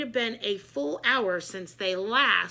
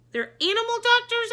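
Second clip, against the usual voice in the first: much higher, louder puzzled question; surprised question; 13 semitones higher, 8 dB louder than usual. A narrator's reading. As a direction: blurt it out in disbelief. Narrator's confused question.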